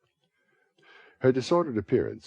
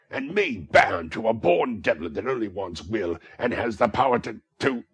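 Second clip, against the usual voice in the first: louder rant that breaks off; raging rant; 3 semitones higher, 7 dB louder than usual. A narrator's reading. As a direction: rage until you run out of breath. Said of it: "mad with rage".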